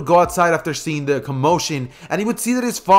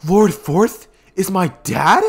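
amazed question; 4 semitones higher, 4 dB louder, slower than usual. Acted. Naruto amazed at the reveal.

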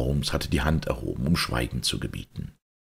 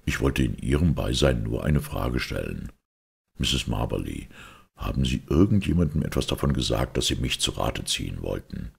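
Holmes. Acted. Voices Holmes questioning the client.

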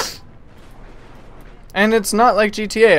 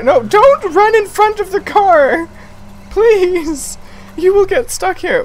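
high, urgent begging at the pedestrians; high frantic please; high pleading; 12 semitones higher, slower than usual. Real, not acted. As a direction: plead with them to move.

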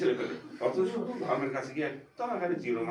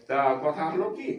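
ordinary talk, nothing marked

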